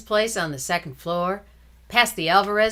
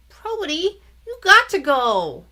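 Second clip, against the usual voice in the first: pleading little brother; 11 semitones higher, 7 dB louder than usual. Acted.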